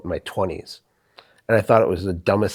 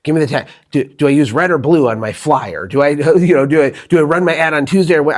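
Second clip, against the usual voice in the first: impatient demand; 5 semitones higher, 6 dB louder than usual. Retold or imitated. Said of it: "I just wanted, like".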